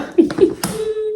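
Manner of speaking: high-pitched squealy giggle; high little laugh; delighted giggle. Real, not acted.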